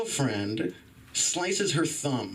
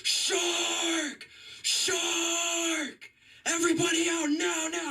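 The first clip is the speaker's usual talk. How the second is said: very high, urgent imitation of a megaphone warning; screaming lifeguard on megaphone; slower than usual. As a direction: scream a beach-wide alarm like a lifeguard. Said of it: "Starts screaming".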